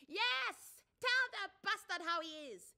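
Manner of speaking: high, loud egging-on voice; cheering bystander woman. A narrator's reading. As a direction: egg her on from the bench.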